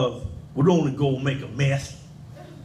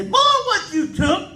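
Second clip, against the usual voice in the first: put-on high squeaky old granny. Grandson imitating granny catching him, squeaky and high. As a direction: shriek it like a scolding old granny. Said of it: "my granny busts in and say".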